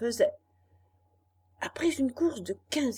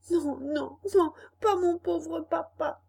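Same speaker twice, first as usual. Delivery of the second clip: high pleading cry; desperate cry; 7 semitones higher than usual. Acted. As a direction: beg in despair. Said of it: "cria Pinocchio avec désespoir".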